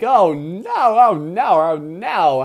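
loud, wailing run of dismay; loud, rising-and-falling alarm; dismayed commentary. Real, not acted. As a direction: cry out like a commentator watching disaster. Commentary as attack lands.

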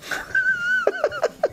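high burst of real laughter. Real, not acted. Storyteller laughing at his own story. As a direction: crack up at the memory.

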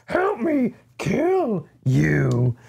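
high, slow, eerie plea; creepy voice. Retold or imitated. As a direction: drag it out like a creepy ghost. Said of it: "it's so creepy".

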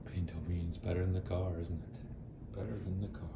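hushed whisper to a sleepy dog. Real, not acted. Whispering to the dog in bed. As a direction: breathe it softly.

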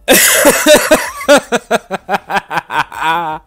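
high booming villain cackle; strained mad scientist cackle; evil laugh. Acted. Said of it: "my evil laugh of evilness".

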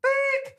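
high squeaky censor beep; imitated bleep.

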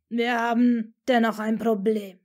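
loud, growled warning. Acted. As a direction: growl it out gruffly. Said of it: "knurrte Moody schließlich".

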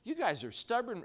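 preacher voicing a prophet's scolding; mock rebuke. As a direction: blast them like an exasperated prophet. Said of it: "sometimes he blasted them".

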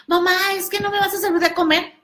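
put-on high, fast pleading kid; whiny daughter. Daughter begs her mother to serve dinner, high whiny voice. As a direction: beg mom for food.

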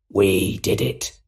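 slow, sinister villain voice; drawn-out, creepy voice in the head; sinister Goblin. Acted. Goblin answers Osborn inside his head, sinister.

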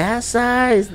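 high, drawn-out imitated holler; mock answering call. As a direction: imitate a coworker calling back in a high voice. (llamado agudo de respuesta, imitado)

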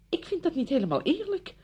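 very high, indignant self-defence; wounded protest. Acted. Visitor refusing to take all the blame, indignant. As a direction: protest the unfairness, pitch leaping up.

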